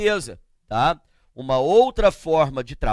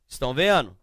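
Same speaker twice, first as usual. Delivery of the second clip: ordinary talk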